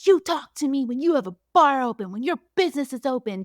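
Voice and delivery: hammed-up outrage at Schwartz; retold angry retort. Lala retold yelling at Schwartz.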